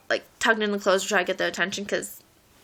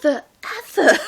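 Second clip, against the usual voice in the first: mock scream; 5 semitones higher, 4 dB louder than usual. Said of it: "you just hear my friend Lamp screaming".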